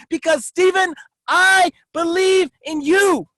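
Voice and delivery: preaching at full force. Acted. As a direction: fire up the room with each I kept going. Rising motivational speech.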